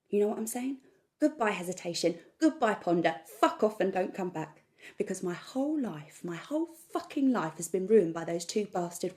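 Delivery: loud, fired-up self pep talk; defiant rant. Acted. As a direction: build up into a loud, furious resolve. Defiant rant against hesitation.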